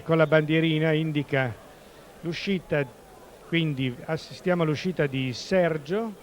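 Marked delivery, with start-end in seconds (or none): none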